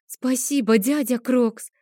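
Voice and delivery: put-on high, sweet little-girl voice; little girl thanking her uncle for a doll, high and sweet; childlike babble. A narrator's reading. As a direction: lisp it out like a delighted small girl. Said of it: "пролепетала Адель".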